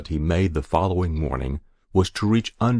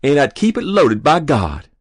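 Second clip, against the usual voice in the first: put-on high, loud boyish excitement; eager boast; 8 semitones higher, 8 dB louder than usual. A narrator's reading. Boy dreaming of his own gun, excited boast. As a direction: swear it loudly and proudly.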